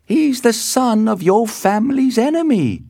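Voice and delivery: very high, loud nurse voice; high, emphatic servant's warning. A narrator's reading. Nurse warns her mistress.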